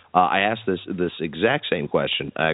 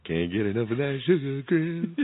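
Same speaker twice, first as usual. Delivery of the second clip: higher, playful imitation of the cereal ad bear; cartoon bear jingle; 8 semitones higher, 4 dB quieter than usual. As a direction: mimic the commercial's bear line with a grin. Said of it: "there's some real innuendo in that commercial".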